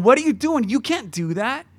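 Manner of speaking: loud, high-pitched incredulous objection; acted scolding. People objecting to the blind beggar, loud mock scolding. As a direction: play an alarmed crowd telling someone off.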